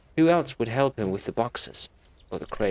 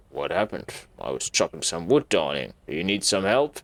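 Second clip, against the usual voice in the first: creepy groundkeeper; 7 semitones lower than usual. Acted. Groundkeeper Robert answering Anne, low and creepy. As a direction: play the creepy old groundkeeper.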